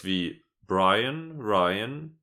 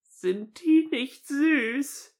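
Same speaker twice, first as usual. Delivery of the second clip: very high, slow cooing; soft cooing voice; 3 dB louder, much slower than usual. Acted. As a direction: coo it sweetly. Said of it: "eine leise Stimme".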